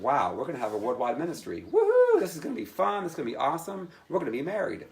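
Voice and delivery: giddy, high-pitched whoop of joy; excited. Retold or imitated. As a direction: cheer it like great news. Excited about future ministry.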